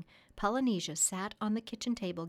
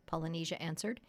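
usual speaking voice

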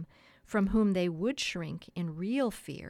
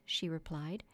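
ordinary delivery

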